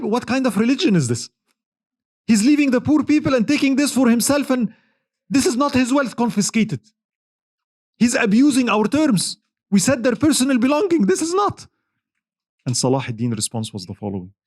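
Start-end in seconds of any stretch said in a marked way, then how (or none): 0.0-11.7 high, outraged protest, mildly acted; high-pitched indignant retelling; indignant scholar. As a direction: point at the greed in disbelief.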